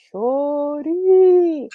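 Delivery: loud, high, long-drawn "Shorty"; imitated catcall. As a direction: holler it like Atlanta guys calling out. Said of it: "all the guys were like".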